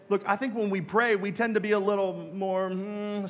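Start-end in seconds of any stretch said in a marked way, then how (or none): none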